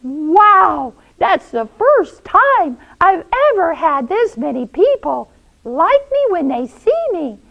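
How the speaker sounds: high, loud, delighted wonder; thrilled and astonished; amazed Elliot. Acted. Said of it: "And Elliot goes".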